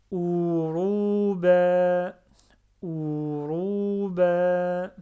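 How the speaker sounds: Uruba read very slowly, the vowels held long; slow, stretched Uruba; prolonged vowels. Shown as a demonstration. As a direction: drag out each vowel of Uruba. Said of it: "je prononce deux fois, même trois fois".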